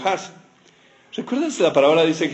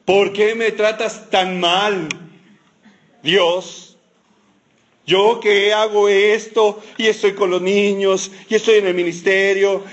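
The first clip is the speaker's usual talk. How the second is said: bitter outburst; 4 semitones higher than usual. Retold or imitated. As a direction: pour out years of bitterness. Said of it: "salió toda la amargura de Moisés".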